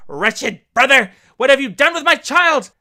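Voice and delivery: a crazed sister crying out at her brother; accusing cry. Acted. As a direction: hurl it at the brother. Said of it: "she cried out".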